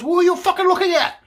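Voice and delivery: very high, loud shout across a room; retold yell. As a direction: holler it like the angry man across the joint. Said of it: "yells across the joint".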